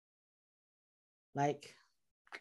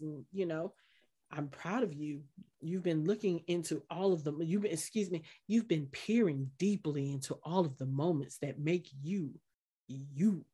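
lower, softer, mock-gentle therapist voice; mock soothing; 4 semitones lower, 4 dB quieter than usual. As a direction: soothe him with fake sweetness. Harold's soothing condescension imitated.

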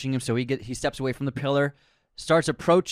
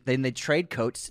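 normal talking voice

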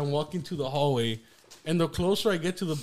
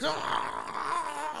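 high, softened mock scream; imitated scream; 10 semitones higher, 5 dB quieter than usual. Brother kicking door and walls on the floor, high mock scream. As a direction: wail high without full volume. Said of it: "he's rolling on the ground".

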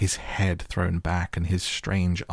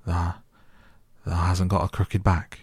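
put-on halting, mildly hoarse old man; 3 dB louder than usual. A narrator's reading. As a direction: stammer the first word, a touch hoarse. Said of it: "he said hoarsely".

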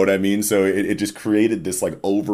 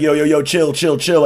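higher, louder frantic hushing; panicked pleading; 10 semitones higher, 7 dB louder than usual. Retold or imitated. Crew begging Luffy to stop, frantic louder pleading. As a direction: beg them all to calm down. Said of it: "everyone's like".

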